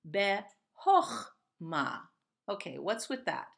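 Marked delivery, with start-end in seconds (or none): 0.1-2.1 Be-hochma said slowly, with an O in hoch; Be-hochma, O sound, slowly; slow careful read. Shown as a demonstration. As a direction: stretch it out: be-HOCH-ma with an O. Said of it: "you're saying O instead of ah".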